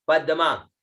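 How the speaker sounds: usual speaking voice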